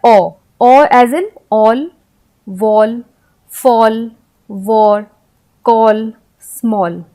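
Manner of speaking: ओ stretched long, then all, wall, fall, war, call, small said one by one; drawn-out vowel demo. Shown as a demonstration. Teacher to class, slowly sounding out words. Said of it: "फिर ए का उच्चारण और क्या होता है?".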